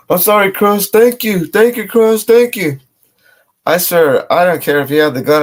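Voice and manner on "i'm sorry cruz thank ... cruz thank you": higher, whimpering grateful pleading; imitating tearful thanks. Scared guy thanking Cruz for sparing him, whimpering high. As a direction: mimic a crier thanking his savior. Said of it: "this motherfucker's crying".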